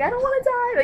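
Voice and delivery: high, loud acted cry; desperate plea. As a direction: imitate a desperate inner cry. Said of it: "she's like".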